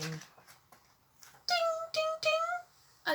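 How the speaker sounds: extremely high tinkling dings; very high dinging. A narrator's reading. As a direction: ring like the tiniest bell. The mystery bell sound.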